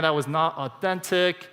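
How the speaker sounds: ordinary talk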